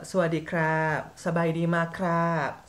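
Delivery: slow, drawn-out Thai greeting; lilting Thai accent, very slow. Shown as a demonstration. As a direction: speak Thai in a demonstrative Thai accent, endings stretched. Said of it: "aksen Thailand ya".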